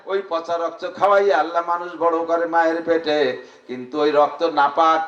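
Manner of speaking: ordinary talk, nothing marked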